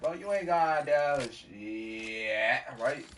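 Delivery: mock tough-guy taunt; taunting bully. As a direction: drag out the dare like a bully. Bully taunting in retold fight.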